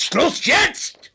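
high, loud, furious shout; yelled command, voice leaping up; angry outburst. Acted. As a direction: explode to shut the questioner up. Cornered man explodes at questioner.

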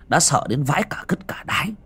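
ordinary delivery

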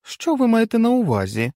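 wife's voice. A narrator's reading. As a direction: press the doctor for meaning.

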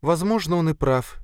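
high, rueful female voice; mild, high-voiced sadness; sad. A narrator's reading. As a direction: concede it sorrowfully. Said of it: "печально ответила Катя".

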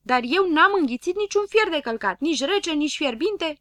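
high, loud, insistent denial; shouted protest. A narrator's reading. Friend rejecting the silly rhyme, shouting indignantly. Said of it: "strigă Grăbilă".

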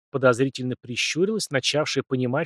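ordinary delivery, nothing marked

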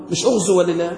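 ordinary talk, nothing marked

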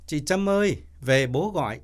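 high-pitched call from the yard. A narrator's reading. Child summons Trâm home from the yard, calling out. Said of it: "có tiếng nói lớn".

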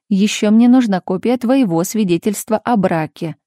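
ordinary delivery, nothing marked

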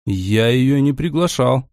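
ordinary delivery

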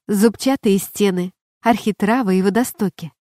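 plain reading voice

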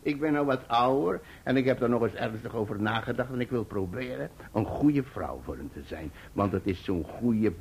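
usual speaking voice

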